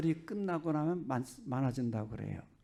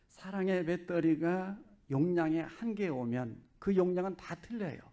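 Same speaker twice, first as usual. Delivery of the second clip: ordinary talk, nothing marked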